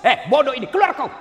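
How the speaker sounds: loud insulting bark; rough ustadz yelling at student; harsh rebuke. Retold or imitated. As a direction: chase the student out angrily.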